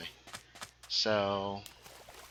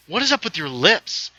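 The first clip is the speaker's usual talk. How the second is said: imitating baffled players, louder and higher; hammed-up demand to know; mock incredulous question; 6 semitones higher, 7 dB louder than usual. Players asking the villain's player about his lips, mock incredulous.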